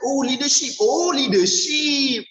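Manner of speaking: the penny drops out loud; sudden realisation. Shown as a demonstration. Coach acts out finally recalling the English word, exclaimed. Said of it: "apa, eh, kepimpinan?".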